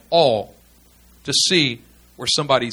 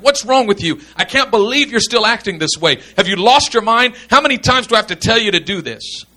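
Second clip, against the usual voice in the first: higher, exasperated pastor telling someone off; frustrated scolding; 5 semitones higher, 4 dB louder than usual. Acted. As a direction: scold them like a pastor at wit's end. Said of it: "When pastors are frustrated, they say things like".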